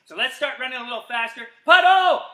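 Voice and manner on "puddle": higher, louder call; excited game call; shouted command. Acted.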